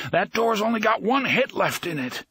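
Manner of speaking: high-pitched urgent warning; straining scream. A narrator's reading. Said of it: "he was trying to scream".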